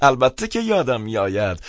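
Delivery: amused, high-pitched reply after a laugh; laugh, then answer in a high voice. A narrator's reading. Priest recognises the caller on the phone, laughing. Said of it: "خندید و گفت".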